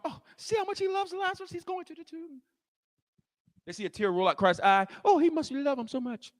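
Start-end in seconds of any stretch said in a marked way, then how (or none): 0.0-2.4 high, soft mock-sympathetic murmur; murmuring crowd. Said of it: "The crowd's talking". 5.0-6.3 soft, higher mock pity; mock-sympathetic onlooker. Imitating the sentimental onlookers.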